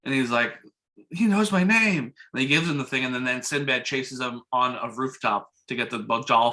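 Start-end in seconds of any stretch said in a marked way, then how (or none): 1.1-2.1 high, slow, drawn-out wonder; amazed boy. Retold or imitated. Retelling the son hearing his name, mock amazement. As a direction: imitate a child's amazement, pitched up.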